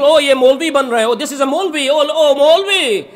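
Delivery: mocking someone who turned pious, a condescending jeer; derogatory and condescending. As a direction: jeer at the new Maulvi with scorn. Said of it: "say it in a really derogatory and condescending manner".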